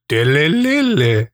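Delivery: slow warbling robot babble; robot noise. Retold or imitated. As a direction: burble it like a broken robot. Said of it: "The robot goes".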